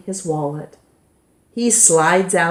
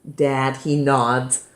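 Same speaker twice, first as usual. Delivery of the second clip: ordinary delivery, nothing marked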